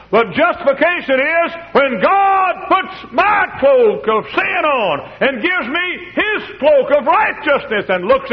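soaring shouted preaching, slow and high; preaching at full force. Acted. Preacher thundering the gospel to the congregation, shouting. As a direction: preach it out at the top of the voice.

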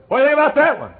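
high, loud curious voice; man on the plane. Retold or imitated. Preacher voicing the chemist on the plane, high and loud. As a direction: pitch him up high and bright with interest.

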